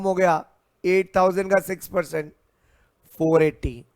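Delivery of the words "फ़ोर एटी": normal talking voice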